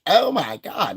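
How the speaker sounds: high, exasperated oh my God; mock disbelief. Streamer recalling his reaction, mock exasperation. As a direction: throw up your hands. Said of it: "And I was like".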